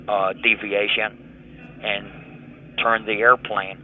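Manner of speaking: ordinary talk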